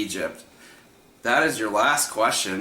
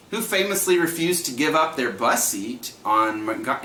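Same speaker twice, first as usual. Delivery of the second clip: usual speaking voice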